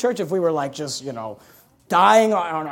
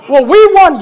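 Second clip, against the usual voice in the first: mock sports fan; 6 semitones higher, 10 dB louder, slower than usual. Mocking a boastful sports fan.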